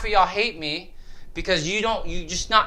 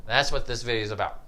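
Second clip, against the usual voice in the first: ordinary talk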